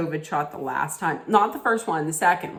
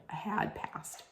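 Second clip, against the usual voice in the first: barely voiced, much softer; whispered grief; 4 semitones higher, 14 dB quieter than usual. Real, not acted. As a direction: let it drop to a whisper of real grief. Telling of father's death.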